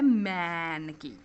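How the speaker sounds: मैन with its vowel drawn out slowly; long vowel sound. Shown as a demonstration. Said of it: "long sound आ रहा है".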